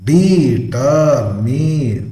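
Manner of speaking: ಡಿಟರ್ಮಿನ್ slowly, in three long beats; syllable by syllable. Shown as a demonstration. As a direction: stretch out each syllable of ಡಿಟರ್ಮಿನ್. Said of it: "three syllables".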